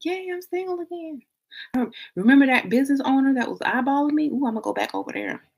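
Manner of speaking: high, soft sing-song yay; giddy cheer. Acted. Woman cheering her breakup to herself, high giddy. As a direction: cheer it like a kid freed from school. Acts celebrating single life again.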